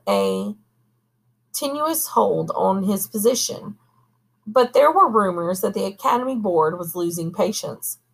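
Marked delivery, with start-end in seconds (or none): none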